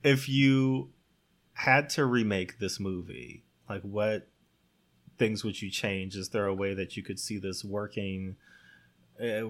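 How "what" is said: normal talking voice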